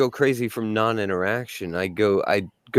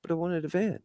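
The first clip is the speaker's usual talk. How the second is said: sweet pleading wife; 6 semitones higher than usual. Acted.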